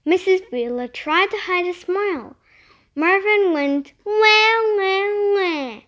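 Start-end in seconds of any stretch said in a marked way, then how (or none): 4.0-5.8 a laughing pig's wah that dies away. A narrator's reading. Pig Marvin laughing at the eaten sports section, wah wah fading. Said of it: "like he was laughing".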